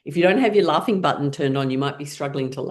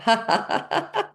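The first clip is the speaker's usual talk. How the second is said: high, rapid staccato laugh; pitched-up exercise laugh; fake laughter; 8 semitones higher than usual. Shown as a demonstration. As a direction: force out rising ha-has while tapping. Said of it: "as you're making laughing sounds".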